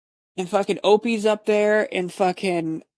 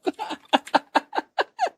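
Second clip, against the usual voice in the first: real high burst of laughter; just crack up; 13 semitones higher than usual. Real, not acted.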